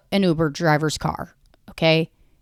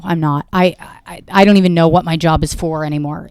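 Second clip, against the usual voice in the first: ordinary talk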